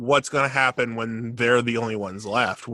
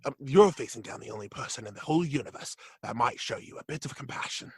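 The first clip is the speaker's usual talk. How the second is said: quick, soft, mock British voice, fading to a whisper; lightly put-on British accent; rushed soft Tennant impression; David Tennant impression; 9 dB quieter, faster than usual. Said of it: "I am a voice actor".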